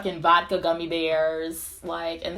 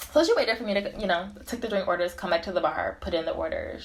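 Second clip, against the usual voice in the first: normal talking voice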